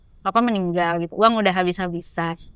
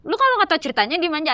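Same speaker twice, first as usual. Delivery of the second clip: imitating a bold younger sibling talking back; higher, feisty retort, acted out; berani; 7 semitones higher, faster than usual. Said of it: "Adek aku tuh berani gitu". (menirukan adik membantah dengan berani, nada lebih tinggi)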